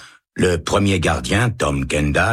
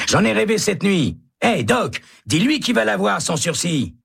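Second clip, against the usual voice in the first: high, insistent cellmate voice; hopeful prisoner. Acted. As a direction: promise him he'll be spared. Prisoner calling to Doc. (voix haute de détenu confiant)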